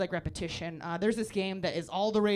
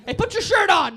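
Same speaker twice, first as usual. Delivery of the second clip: high, loud call-out; teacher yelling; 10 semitones higher, 13 dB louder than usual. Retold or imitated. Teacher shouting at a kid in the chaos, loud call. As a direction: yell across the chaos. Kids running wild.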